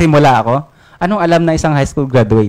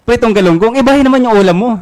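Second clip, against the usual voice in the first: higher, louder nagging complaint; exasperated scolding; 8 semitones higher, 3 dB louder than usual. Acted. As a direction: scold your coworker loudly. Pedro scolds Juan over lunch. (pasigaw na pagsermon, mas mataas at malakas)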